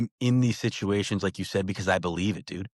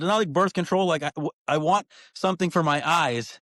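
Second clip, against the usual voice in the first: exasperated; 7 semitones higher than usual. Retold or imitated. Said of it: "I said".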